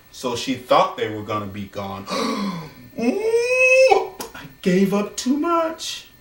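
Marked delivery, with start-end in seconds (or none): 2.1-2.7 a quick, hammed-up startled gasp; soft theatrical gasp; mock gasp. [name] caught out, gasping. 3.0-6.1 put-on squealed ooh, much higher, in a girlish voice; hammed-up girlish alarm; mock dismay. [name] dismayed after gasp.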